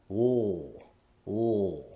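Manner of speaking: a long, held dark L. Shown as a demonstration. Teacher letting students hear the sound. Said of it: "back of my tongue is pulled up".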